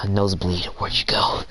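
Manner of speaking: breathy creeper whisper after a sigh; creepy whisper. Pretend. Mocking the creepy friend who texts, whispering. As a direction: hush it like a lurking creep. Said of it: "be a creeper and go like".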